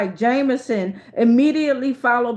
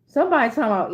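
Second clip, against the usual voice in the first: usual speaking voice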